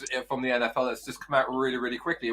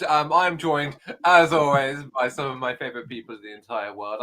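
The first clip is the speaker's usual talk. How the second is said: ordinary talk, nothing marked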